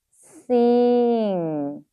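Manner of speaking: "Sing" stretched long; sounding out while writing. Shown as a demonstration. Teacher telling learners to say it while writing, "Sing" drawn out. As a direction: hold "Sing" out, sliding gently down. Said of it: "발음하시면서 씁니다".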